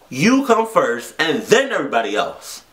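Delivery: ordinary delivery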